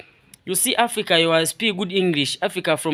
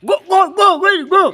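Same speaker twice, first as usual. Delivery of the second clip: police shouting; 13 semitones higher, 6 dB louder than usual. Acted. Acting out police arrest scene.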